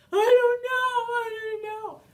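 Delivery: put-on high, loud, sing-song child whine; stumped kid whining back to the parent, sing-song and high; whiny stumped child.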